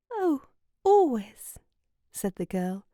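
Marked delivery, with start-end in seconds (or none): 0.1-1.6 dreamy. Acted. Dreamy girl answers slowly.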